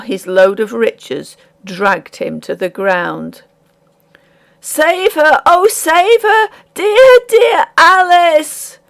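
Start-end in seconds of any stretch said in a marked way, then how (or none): 4.6-8.8 very high, loud and slowing into dear Alice; the miller crying out as Alice drowns; ringing cry. A narrator's reading. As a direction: hurl the cry across the water. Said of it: "rang over the waters".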